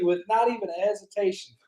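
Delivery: ordinary talk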